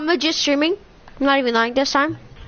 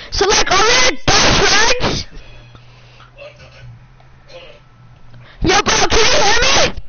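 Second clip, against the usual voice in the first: high-pitched loud yelling question; loud shout into the mic; screaming; 6 semitones higher, 3 dB louder than usual. Real, not acted. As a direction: yell it high, rising at the end. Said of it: "you should stop screaming".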